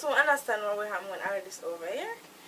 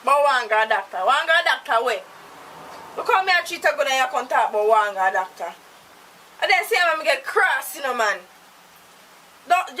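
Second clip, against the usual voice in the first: loud, driving tirade; furious scolding; 7 dB louder than usual. Acted. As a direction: tear into him for the insult. Furious reply in the quarrel.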